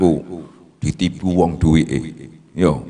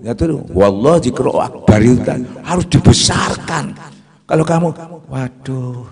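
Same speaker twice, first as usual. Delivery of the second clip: normal talking voice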